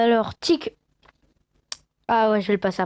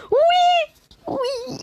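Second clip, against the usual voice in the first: real joyful squeal; 3 dB louder than usual. Real, not acted. Gamer still celebrating the lucky box, high joyful squeals. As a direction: squeal it high, then let it fade.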